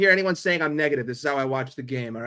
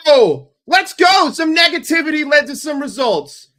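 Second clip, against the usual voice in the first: loud, high ecstatic shout; goal celebration; 10 semitones higher, 10 dB louder than usual. Real, not acted. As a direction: scream with joy at the goal.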